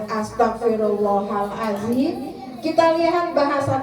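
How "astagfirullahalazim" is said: istighfar lembut, lebih rendah dan pelan; slow calm istighfar. Shown as a demonstration. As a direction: say it slowly and gently, lower. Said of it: "Bicara pelan dan sopan". (ucapkan "Astagfirullahalazim" dengan pelan dan tenang)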